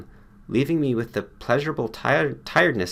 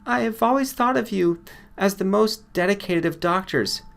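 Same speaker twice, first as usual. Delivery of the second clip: higher woman's voice, earnest devotion; admiring female patient; 11 semitones higher than usual. Acted. Patient tells her doctor he's dedicated, higher and earnest. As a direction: pour out heartfelt praise.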